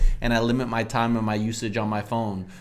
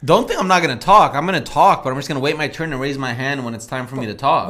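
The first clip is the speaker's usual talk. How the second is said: mouth taped shut; 2 semitones higher, 7 dB louder, faster than usual. Real, not acted. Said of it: "have the tape on your face".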